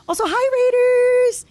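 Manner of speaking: high, slow, singsong hello; excited greeting. Real, not acted. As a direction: greet the raid with glee. Greeting incoming raiders on stream.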